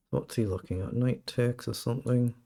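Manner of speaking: usual speaking voice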